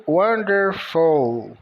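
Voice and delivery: wonderful, W said as U, slow; slow English wonderful. Shown as a demonstration. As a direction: spell out wonderful slowly. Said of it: "O W com o som de U". (wonderful dito devagar, com W de U)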